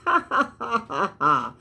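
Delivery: deliberate, staged laughter stepping down in pitch; mock laugh. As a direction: laugh on cue at your own joke. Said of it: "Let's all laugh".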